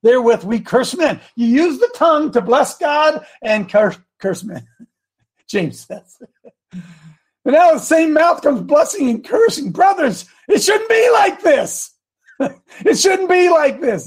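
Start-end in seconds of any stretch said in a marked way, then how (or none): 0.0-4.6 animated, raised declamation; preaching scripture. Acted. Preacher reading James on the tongue, declamatory. 7.4-14.1 high, exclaiming scripture plea; impassioned preaching. Acted. Preacher rebuking the brothers from James, impassioned.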